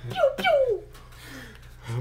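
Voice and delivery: high blaster noises; squeaky laser zaps; pew pew. Retold or imitated. Blaster sound imitation.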